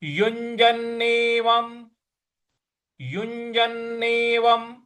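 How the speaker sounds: युञ्जन्नेवं said slowly with the nasal युं stressed; careful demo of युञ्जन्नेवं; recitation demo. Shown as a demonstration. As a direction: sound युञ्जन्नेवं as yun-jan-ne-vam, held. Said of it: "युं इस तरह से बोलना है।".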